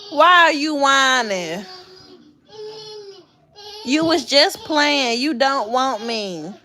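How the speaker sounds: slow, high, coaxing. Real, not acted. Mom gently teasing her whining son.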